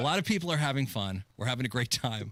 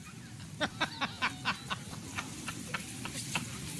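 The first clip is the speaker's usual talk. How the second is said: long airy giggle, pitched up; breathy laugh; 9 semitones higher, 9 dB quieter than usual. Real, not acted. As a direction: crack up at your own line. Laughing at own bit.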